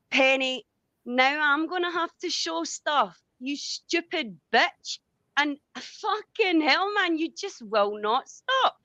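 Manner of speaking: high, slow, furious tirade at Penny; furious outburst. Real, not acted. Turns on Penny in anger.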